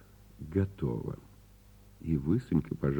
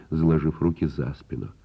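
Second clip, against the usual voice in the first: ordinary delivery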